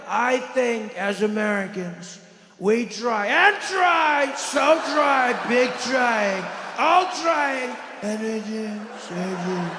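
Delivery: mock Trump with wild volume and odd inflection; hammed-up Trump rambling; erratic Trump. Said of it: "volume on his voice all over the place".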